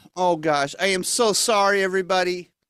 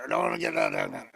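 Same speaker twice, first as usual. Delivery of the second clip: much lower, fading down and away; voice sinking away; 6 dB quieter, faster than usual. Acted. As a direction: sound like you're being pushed down into the box. Puppet pushed down out of sight.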